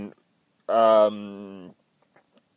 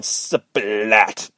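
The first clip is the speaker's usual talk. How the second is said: slow, stretched-out splat; imitated splat; 4 dB louder than usual. Retelling a soldier hitting the ground, exaggerated splat. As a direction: draw out the impact noise.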